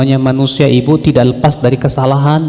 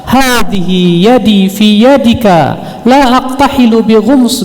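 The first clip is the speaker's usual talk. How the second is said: high, slow, drawn-out hadith recital; preaching recitation; 7 semitones higher, slower than usual. Acted. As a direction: proclaim the hadith in long, ringing phrases. (ceramah lantang membacakan hadis dengan nada tinggi dan lambat)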